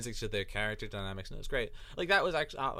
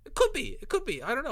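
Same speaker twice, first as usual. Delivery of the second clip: surprised; 4 dB louder, faster than usual. Real, not acted. Surprised reply to accent question.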